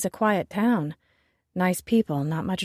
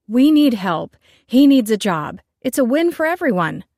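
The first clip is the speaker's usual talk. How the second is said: much louder, pushing back hard; heated arguing; 5 semitones higher, 9 dB louder than usual. Acted. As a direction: fight for the hire. Nell on the warpath arguing.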